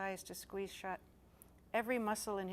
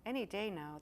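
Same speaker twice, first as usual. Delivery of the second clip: plain reading voice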